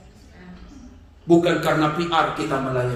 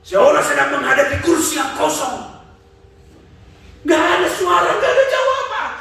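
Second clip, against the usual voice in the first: fervent preaching; 13 semitones higher, 5 dB louder than usual. Acted. Preaching at full force.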